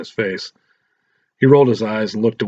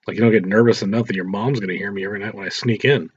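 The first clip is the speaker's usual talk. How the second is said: usual speaking voice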